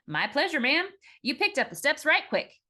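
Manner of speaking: put-on young sailor voice. A narrator's reading.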